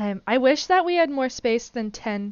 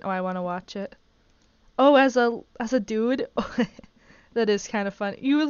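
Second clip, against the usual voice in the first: usual speaking voice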